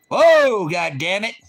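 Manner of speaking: sudden loud, high whoa; mock horse-reining holler. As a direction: holler as if pulling back the reins.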